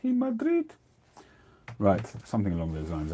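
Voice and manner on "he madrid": much higher, broken-English Spanish voice; Spanish accent. Shown as a demonstration. Telling viewers where the roller lives, in a high Spanish accent. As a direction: pipe it up like a Spaniard. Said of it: "get my lisp on".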